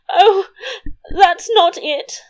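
high, loud tearful wail; acted sobbing. A narrator's reading. Queen protests to unsympathetic king, acted high sob. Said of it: "sobbed her majesty".

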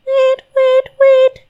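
very high, short piping bird calls; high sung birdcall; nightingale song. Acted. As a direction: chirp it like a nightingale on one high note. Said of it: "changed into a nightingale and sang".

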